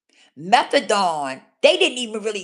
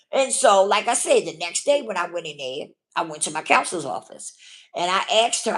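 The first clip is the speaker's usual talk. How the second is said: ordinary talk, nothing marked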